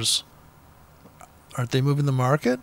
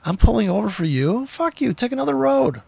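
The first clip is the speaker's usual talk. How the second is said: mock yell at siren; 8 semitones higher than usual. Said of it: "my adrenaline's just like".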